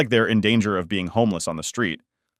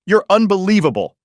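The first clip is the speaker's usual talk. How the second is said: sudden furious shout, much higher and louder; furious yell; 10 semitones higher, 6 dB louder than usual. Acted. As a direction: explode at him from sad to furious. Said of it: "she yells".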